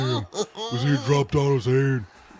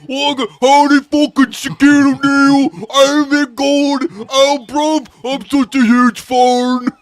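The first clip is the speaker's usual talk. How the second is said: goofy British guy; 13 semitones higher, 11 dB louder than usual. Acted. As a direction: yell it like a dopey British fan. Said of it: "Mister Goofy British guy".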